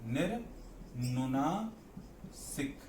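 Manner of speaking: निर्नुनासिक stretched very slowly, syllable by syllable; slow drawn-out term. Shown as a demonstration. Teacher spelling out a new term for students, very slow. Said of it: "होता है निर्नुनासिक".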